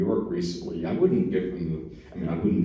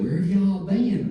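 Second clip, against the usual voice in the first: a much higher, cheerful shop lady's greeting; friendly Southern shopkeeper; 12 semitones higher, 3 dB louder than usual. Retold or imitated. Store lady greeting a returning customer, much higher and chirpy. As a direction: chirp it like a friendly small-town clerk. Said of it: "she said".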